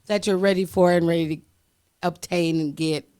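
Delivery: ordinary talk, nothing marked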